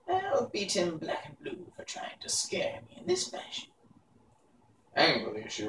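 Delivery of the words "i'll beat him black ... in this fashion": high crone voice, slow menacing very good; angry old witch. A narrator's reading. Mombi threatens the boy who tried to scare her.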